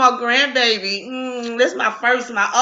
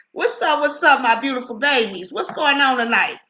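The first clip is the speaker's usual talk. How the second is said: normal talking voice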